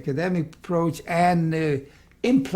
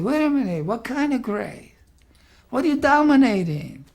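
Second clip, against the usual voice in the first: raised pitch, pushing the student for more; pressing challenge; 6 semitones higher, 3 dB louder than usual. Retold or imitated. Teacher pressing a nervous student, in a raised, challenging voice. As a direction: press him hard, voice climbing.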